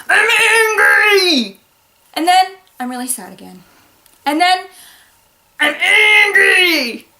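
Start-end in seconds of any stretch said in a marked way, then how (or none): 0.1-1.6 high, drawn-out hammed-up anger; comic outburst of pretend rage; mock angry. Shown as a demonstration. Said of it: "they go something like this". 2.8-3.6 downcast mock sadness; understated, deflated play-acting; mock sad. Shown as a demonstration. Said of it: "they go something like this". 5.6-7.1 high, drawn-out hammed-up anger; comic outburst of pretend rage; mock angry. Shown as a demonstration. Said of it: "they go something like this".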